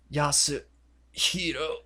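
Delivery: slow, broken-up last word; mock dying gasp. Dying victim names the killer, mock dying gasp. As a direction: drag the name out as if expiring.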